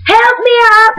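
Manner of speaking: high, urgent mock cry for help; mock plea. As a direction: shriek it like a desperate swimmer. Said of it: "he's like".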